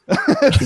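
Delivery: high-pitched burst of laughter. Real, not acted. Laughing at Farmville story.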